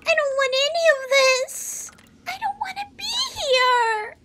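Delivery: high-pitched, whimpering crying voice; acted tearful fear. Voices a frightened, crying game character.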